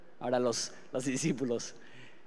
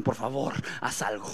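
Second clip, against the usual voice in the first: softer, lower "Por favor, haz algo" trailing off; quiet plea; 5 semitones lower, 6 dB quieter than usual. Retold or imitated. Disciples dropping their oars, softly begging Jesus. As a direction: beg softly, almost giving up.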